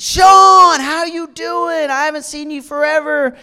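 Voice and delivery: put-on high, loud, delighted hello to an old friend; excited greeting. Calling across the grocery store to old friend Sean, thrilled. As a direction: call out to him like he's a long-lost buddy.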